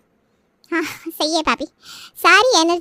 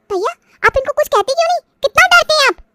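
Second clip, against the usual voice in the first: much higher, louder, goading her brother; shrill taunt; 10 semitones higher, 3 dB louder than usual. Acted. Sister taunting her brother in the family quarrel, high and sharp. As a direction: jab at him for being scared.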